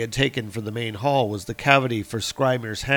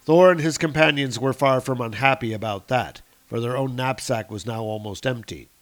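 ordinary delivery

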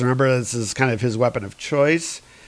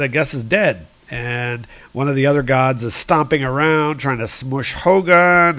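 ordinary talk

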